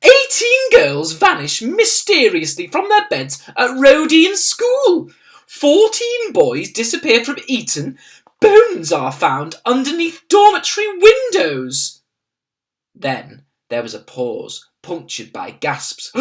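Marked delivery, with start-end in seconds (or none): 0.0-12.0 high, loud, aghast royal voice reading news; a shocked Queen over her newspaper; Queen reading aloud. A narrator's reading. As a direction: read it out in rising horror. Queen reads the headline aloud.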